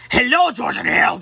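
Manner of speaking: menacing crow. Acted. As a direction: greet them like a triumphant villain. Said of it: "crowed Timmy".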